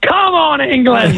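fired-up football fan cheer; excited cheer. Real, not acted. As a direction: cheer your team on, high and eager. Drunk fan cheering at match.

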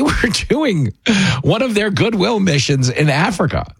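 ordinary talk

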